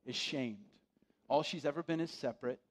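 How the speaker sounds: usual speaking voice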